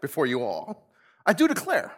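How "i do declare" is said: high, emphatic mock declaration; hammed-up sitcom boss declaring; Michael Scott impression. Pastor mimics Michael Scott to the congregation, hammed-up. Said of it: "says Michael Scott".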